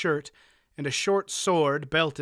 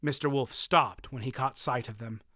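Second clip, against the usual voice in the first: ordinary delivery, nothing marked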